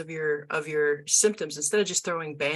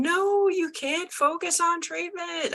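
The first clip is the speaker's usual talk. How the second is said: high, drawn-out cry of objection; hammed-up exasperated objection; mock pleading protest; 13 semitones higher, slower than usual. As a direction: stretch it out high, like calling to a crowd. Acting out herself protesting to groups.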